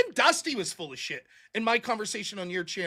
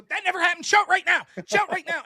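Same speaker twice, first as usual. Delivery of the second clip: loud, higher insistent demand; mock angry demand; 7 semitones higher, 5 dB louder than usual. Imitating a debater loudly demanding proof. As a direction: hammer the demand to show it.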